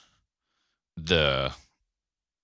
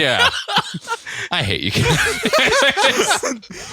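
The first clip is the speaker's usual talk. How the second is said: high, slow, laughing complaint; much slower than usual. Real, not acted. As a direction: groan it out through laughter. Cracking up at innuendo.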